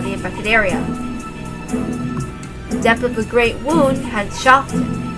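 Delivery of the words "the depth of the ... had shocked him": ordinary delivery, nothing marked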